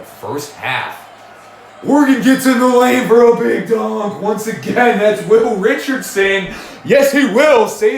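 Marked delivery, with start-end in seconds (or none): 1.9-6.5 high, loud play-by-play burst; big live dunk call; excited call. Real, not acted. 6.8-8.0 high, loud catchphrase yell; thrilled shout of the catchphrase; excited shout. Real, not acted.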